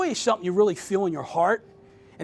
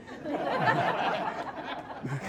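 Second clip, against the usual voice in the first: soft breathy laugh; 8 semitones higher, 8 dB quieter than usual. Real, not acted. Laughs at own lapse.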